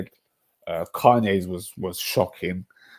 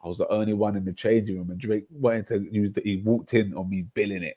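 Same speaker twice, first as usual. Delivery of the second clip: ordinary delivery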